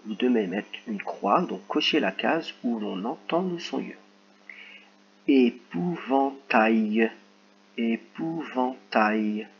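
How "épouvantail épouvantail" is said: each syllable stretched very slowly; teacher reading a syllable exercise, very slow and clear; slow dictation. Shown as a demonstration. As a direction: draw it out so they can count syllables. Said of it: "Donc c'est parti".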